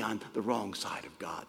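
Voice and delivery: whisper. Real, not acted. Solemn point about fearing God.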